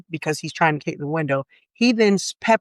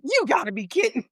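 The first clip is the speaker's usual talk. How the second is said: exasperated disbelief; 6 semitones higher, 3 dB quieter than usual. Retold or imitated. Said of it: "And I'm like".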